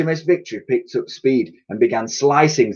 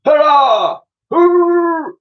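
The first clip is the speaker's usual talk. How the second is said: much higher, louder hurrah; called out cheer; 6 dB louder, slower than usual. A narrator's reading. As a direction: cheer it like a happy mammoth. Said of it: "called out Woolly".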